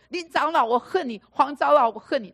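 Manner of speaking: loud, high pretend outburst at the elders; mock shout. Believer imagining yelling at church elders, mock loud outburst. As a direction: blurt it loudly, then drop back. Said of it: "又不能大声地说".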